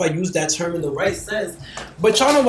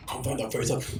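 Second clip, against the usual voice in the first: imitating him, lower and soft, teasing; mock sultry reply; 6 semitones lower, 12 dB quieter than usual. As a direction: drop the voice low and coy. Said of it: "that sound like on some".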